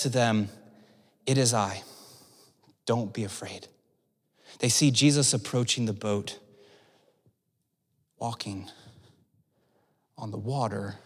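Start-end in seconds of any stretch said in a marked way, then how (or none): 1.2-3.7 low, hushed and gentle; quiet, steady comfort, dropping low; calm reassurance. Acted. Voicing Jesus calming frightened disciples. 4.6-11.0 low, soft, with long pauses; reverent, subdued retelling; hushed awe. A narrator's reading. Slow hushed re-reading for awe.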